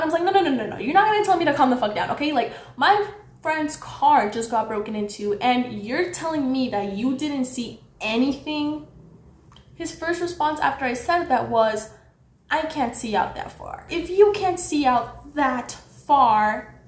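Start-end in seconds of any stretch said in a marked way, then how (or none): 0.2-8.9 heated. Retold or imitated. Arguing back at the guard over friend's car, heated. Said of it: "now I'm raising my voice". 13.9-16.7 slow, emphatic, heated echo; incredulous retort. Retold or imitated. Mocking the guard's excuse back at him, incredulous.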